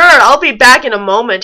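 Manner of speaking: ordinary delivery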